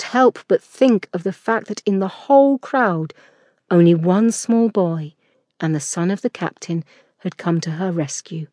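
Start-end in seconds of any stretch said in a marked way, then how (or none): none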